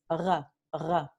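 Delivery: r rasped at the back of the throat; a uvular r like in French. Shown as a demonstration. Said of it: "consonante muy común en francés".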